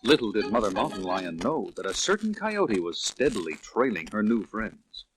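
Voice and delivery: ordinary delivery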